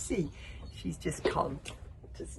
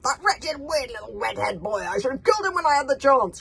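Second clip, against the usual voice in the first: furious high scream, much louder; screaming in rage; 8 semitones higher, 14 dB louder than usual. Acted. Villain screams up at the boy who escaped. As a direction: scream it at the boy in the crow's nest. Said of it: "screamed Norbert the Nut".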